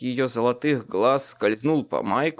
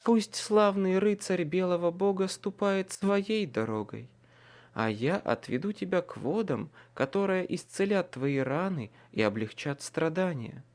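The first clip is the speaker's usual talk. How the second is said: soft purring temptress; languid alluring voice; 5 semitones higher, 4 dB quieter than usual. Acted. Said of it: "томным и манящим".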